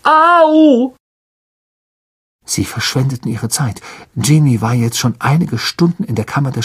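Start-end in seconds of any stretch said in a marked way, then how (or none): 0.0-1.0 high sharp ouch; yelp of pain. A narrator's reading. Lockhart kicked in the shin: sharp pained yelp. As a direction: cry out when kicked.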